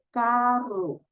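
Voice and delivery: "carro" very slow with a long trilled rr; rolled r. Shown as a demonstration. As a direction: stretch the rr in "carro" slowly. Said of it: "Óigase bien". ("carro" lento, con la erre larga y vibrante)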